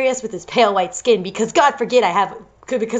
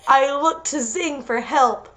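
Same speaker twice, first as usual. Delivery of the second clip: ordinary delivery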